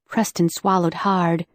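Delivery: plain reading voice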